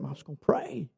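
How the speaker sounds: low, very soft imitated answer; understated reply. Imitating buddy answering about financial difficulties, hushed and low. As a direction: drop right down, quietly matter-of-fact.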